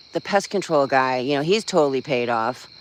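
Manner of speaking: ordinary talk, nothing marked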